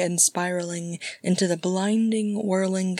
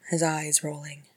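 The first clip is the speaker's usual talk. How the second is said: ordinary delivery, nothing marked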